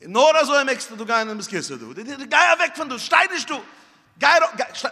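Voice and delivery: high, loud shooing; mother shooing the boy away, high and loud. Retold or imitated. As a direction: chase him off the cushions. Shooing child away from cushions.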